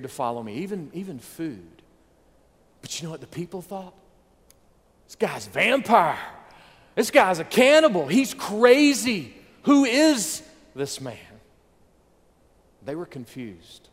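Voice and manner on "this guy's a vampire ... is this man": loud, higher, rising alarm; mock-horrified shout from the crowd. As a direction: exclaim it like a shocked mob. Crowd exclaiming Jesus is crazy.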